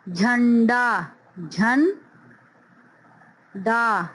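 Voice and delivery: झंडा said slowly then broken झन, डा; syllables apart; slow syllable split. Shown as a demonstration. Teacher breaking झंडा into syllables. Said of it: "इसी तरीके से देखिए".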